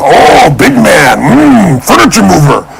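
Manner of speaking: slow, appraising foreman sizing up a big man; sizing-up drawl. Retold or imitated. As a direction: drag it out while looking him over. Guy sizing him up for labor.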